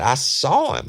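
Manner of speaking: high, loud excited outburst; acting out someone's eager revelation; imitated excitement. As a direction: blurt it out like an overexcited witness. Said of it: "He's so excited".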